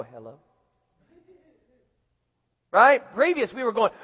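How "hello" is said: sudden quiet, polite phone hello; fake sweet hello. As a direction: switch to a sweet put-on phone voice. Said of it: "put that on just like that".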